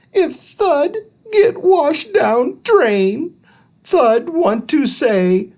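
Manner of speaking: very high squeaky farewell; a tiny alien saying goodbye; tearful little alien. Acted. Thud to Andrew before the drain, squeaky and sad.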